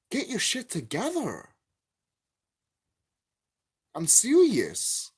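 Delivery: furious vlogger scolding the game company; angry rant. Real, not acted. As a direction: yell at them to get it together.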